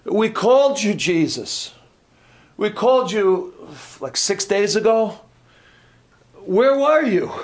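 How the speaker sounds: frustrated Martha. Shown as a demonstration. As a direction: accuse Jesus of coming too late. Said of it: "You think that she".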